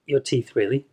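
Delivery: normal talking voice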